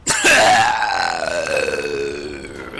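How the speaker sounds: low grunting caveman laugh; guttural caveman laugh. Real, not acted. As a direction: let out a deep caveman noise. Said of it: "that was a caveman noise".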